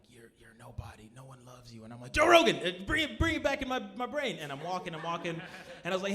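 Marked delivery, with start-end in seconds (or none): 0.1-1.8 low, very soft self-talk; bleak inner voice. Retold or imitated. Brain doing the fractal thing, bleak quiet self-talk. 2.1-4.4 high, loud call for rescue; sudden mock-desperate outburst; urgent plea. [name] begs Joe Rogan to fix his brain, urgent.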